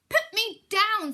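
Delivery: high, loud, slow squeal; shrieking fish. Acted. Fish held aloft begging the cat, loud high squeal. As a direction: squeal it out, dangling in the air.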